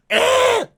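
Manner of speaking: very high, loud mock screech; short hammed-up tantrum squeal; mock scream. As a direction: cap the imitation with a shrill squawk. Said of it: "and start screaming".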